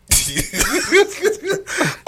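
big high laugh. Real, not acted.

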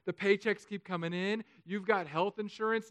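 ordinary talk, nothing marked

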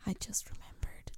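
hushed, voiceless whisper; barely audible whispered aside. Pretend. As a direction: whisper it like a secret to your co-host. Sudden secretive reminder to co-host.

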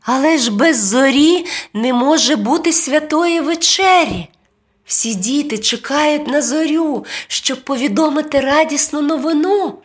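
put-on high, pleading child voice; lightly voiced young boy; boy exclaiming. A narrator's reading. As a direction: appeal earnestly as a little boy. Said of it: "вигукнув Максим".

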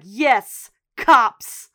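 loud, emphatic mock threat; forceful retold comeback; insistent retort. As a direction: punch each word hard, standing your ground. Retold standoff, she insists on police.

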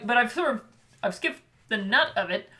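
ordinary talk, nothing marked

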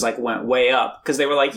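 ordinary talk

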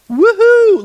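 high loud mock whoop of joy; excited whoop. Real, not acted. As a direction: cheer it out, tongue in cheek. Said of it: "This is awesome".

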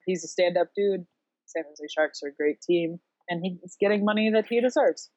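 usual speaking voice

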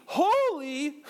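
high, stretched word that cracks; voice cracking. Real, not acted. Preacher emphasizing the repetition of holy, voice accidentally cracking high. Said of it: "That was, my voice cracked".

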